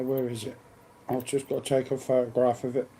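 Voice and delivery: ordinary delivery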